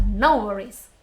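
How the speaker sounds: ordinary talk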